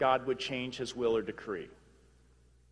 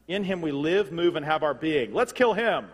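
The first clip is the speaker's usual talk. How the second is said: loud, higher, hammed-up battle cry; mock rebel rallying; 4 semitones higher, 7 dB louder than usual. Preacher mimicking rebels plotting against their maker, mock rallying cry. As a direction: build to an absurd, punchy rallying call.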